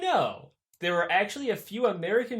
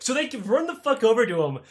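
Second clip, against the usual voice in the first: normal talking voice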